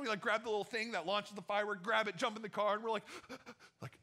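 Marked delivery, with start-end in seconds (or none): 3.1-3.8 a sharp soft intake of breath; breathless gasp. Retold or imitated. Teens diving into the car, a sharp relieved gasp. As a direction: gasp with relief after the escape. Jumping in car after escape.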